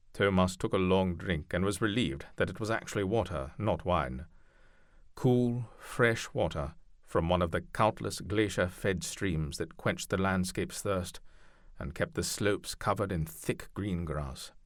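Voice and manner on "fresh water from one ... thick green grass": ordinary delivery, nothing marked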